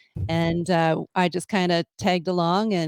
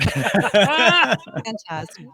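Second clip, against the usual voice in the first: drawn-out sarcasm; 3 semitones higher, 5 dB louder, much slower than usual. Real, not acted. Teased about going first.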